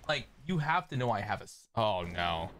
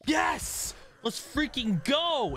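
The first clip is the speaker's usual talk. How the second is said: excited; 13 semitones higher, much slower than usual. Real, not acted. Big win in game.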